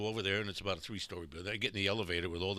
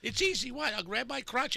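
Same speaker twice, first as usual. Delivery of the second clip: high voice; 3 dB louder than usual. Shown as a demonstration. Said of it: "my voice goes right where it belongs".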